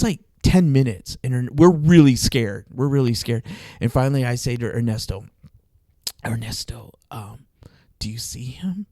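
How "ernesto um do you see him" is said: soft, cautious near-whisper; acting out asking in secret, very quietly; hushed fearful whisper. Scared driver checking with passenger about the cop, hushed whisper.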